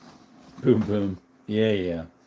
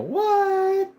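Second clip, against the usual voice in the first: high startled what; real surprise at the news; surprised what. Real, not acted. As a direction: shoot the word up in disbelief. Hears patch stolen from mail.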